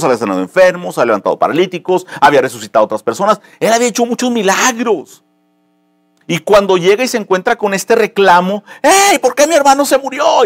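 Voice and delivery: high, loud impassioned preaching; preaching at full force. Acted. Pastor preaching to his church, impassioned and loud. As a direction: lift the congregation, pleading and loud.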